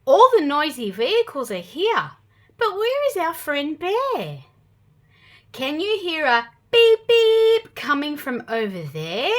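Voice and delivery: ordinary delivery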